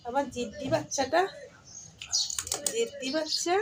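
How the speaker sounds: high tender cooing; affectionate pet talk. Real, not acted. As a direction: coo fondly at the stubborn cat. (আদুরে গলায় ডাকা)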